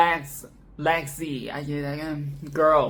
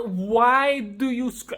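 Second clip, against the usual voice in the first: usual speaking voice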